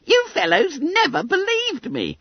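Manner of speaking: high, squeaky schoolboy complaint; reproachful. A narrator's reading. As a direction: protest in a high, hurt boy's voice. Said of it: "blinking reproachfully at the famous five".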